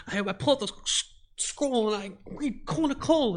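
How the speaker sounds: high, slow, triumphant announcing; excited player. Retold or imitated. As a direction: declare the spell like a player sure he's won. Player retells his turn.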